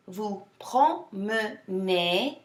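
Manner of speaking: "Vous promenez" very slowly, syllable by syllable. Shown as a demonstration. Teacher for students repeating after her, slow syllable breakdown. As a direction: pull "promenez" apart into three slow syllables. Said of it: "break it down slower".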